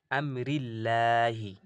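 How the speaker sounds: আমরিল্লাহি drawn out slowly, thin ল; recited light. Shown as a demonstration. A Quran teacher to students, reciting a light example. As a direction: chant আমরিল্লাহি thin and slow. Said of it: "দেখুন লা".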